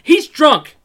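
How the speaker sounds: exasperated, acted-out insistence; insistent repeat. Repeats retold plea more insistently.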